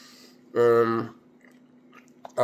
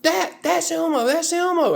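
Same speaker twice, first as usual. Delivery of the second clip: put-on higher, eager little kid insisting; child voice. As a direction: play the ten-year-old sure of it. Kid correcting him, voiced.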